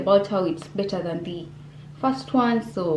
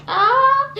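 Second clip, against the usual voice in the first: short, loud, very high-pitched "ah"; high squeal; 5 dB louder than usual. Real, not acted. Giving up on the British accent, a high squeal.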